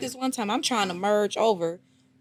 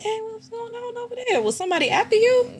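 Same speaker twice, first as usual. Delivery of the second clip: shocked exclamation; 7 dB louder than usual. Retold or imitated. Startled reaction retold, quoted.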